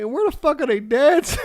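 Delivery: high, loud baffled question; incredulous shout. Retold or imitated. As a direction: yell it in disbelief. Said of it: "I'm like".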